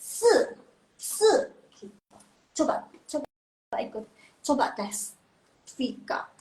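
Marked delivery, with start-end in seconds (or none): 0.0-1.3 si and shi high and loud, falling sharply; fourth-tone drill. Shown as a demonstration. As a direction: drive each syllable down hard. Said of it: "dari atas ke bawah terus miring".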